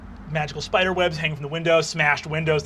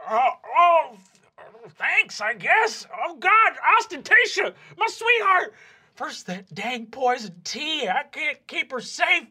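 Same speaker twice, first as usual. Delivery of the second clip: high, loud, choked grieving dad; anguished father; 9 semitones higher, 5 dB louder than usual. Acted. Dwarven dad grieving his trapped daughter. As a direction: sob out his worry for his daughter. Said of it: "he says".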